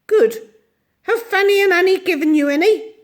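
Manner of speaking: put-on high, bright old little woman voice; tiny ancient shopkeeper. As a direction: chatter away as a tiny ancient lady. Mrs Corey questions.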